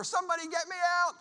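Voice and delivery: high, loud, fast panicked yell; frantic fast shout for rescue. Retold or imitated. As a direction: scream for help as the lion closes in. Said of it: "he yells louder".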